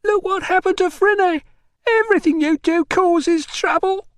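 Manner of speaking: high, loud, anguished shriek; accusing shriek. A narrator's reading. Mother blaming visitor for interfering, shrieking. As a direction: shriek the question at him. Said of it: "she shrieked".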